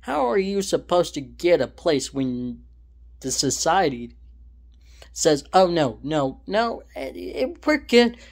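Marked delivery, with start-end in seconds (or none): none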